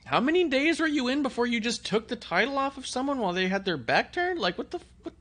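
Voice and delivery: high-pitched mock indignation; incredulous comeback, voice pushed way up high; Liv. Host plays the wrestler calling out her rival, high and incredulous. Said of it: "if Liv looked at her and said".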